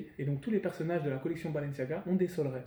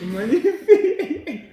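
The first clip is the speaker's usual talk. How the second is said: very high, loud, drawn-out cheer; mock rapture; 14 semitones higher, 13 dB louder, much slower than usual. Host praising friend's accent, hammed-up rapture. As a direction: stretch it out in over-the-top mock admiration.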